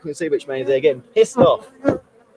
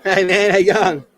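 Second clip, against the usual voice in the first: loud, high and strained; loud strained greeting; 6 semitones higher, 7 dB louder than usual. Real, not acted. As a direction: call out through the pain. Greeting someone while stung, loud.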